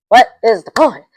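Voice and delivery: first fed-up take; exasperated question. Real, not acted. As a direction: ask it at the screen, annoyed.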